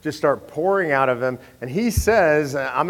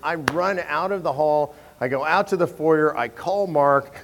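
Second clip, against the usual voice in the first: ordinary talk, nothing marked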